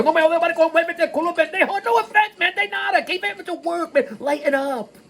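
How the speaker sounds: high-pitched, rapid-fire mock rant; mock Spanish rant. Joking aside to Spanish-speaking friends, mock rant.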